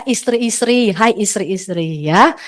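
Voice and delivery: ordinary delivery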